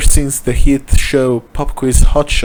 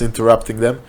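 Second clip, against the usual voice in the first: usual speaking voice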